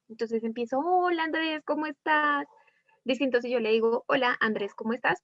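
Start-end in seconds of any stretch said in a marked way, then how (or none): 0.8-2.5 butter Andrés up with a flirty greeting. Shown as a demonstration. Greeting Andrés to ask him a favor, flirty. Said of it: "puede ser que ese coqueto". 4.1-5.2 plain, businesslike hello; serious, passing. Shown as a demonstration. Teacher showing a passing greeting to the class, serious. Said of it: "es algo más serio y que es algo más pasajero".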